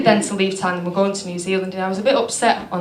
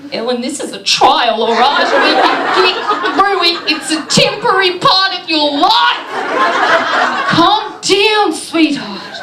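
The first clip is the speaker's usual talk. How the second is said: put-on Australian accent; 9 semitones higher, 3 dB louder than usual. Self-soothing again in Australian voice.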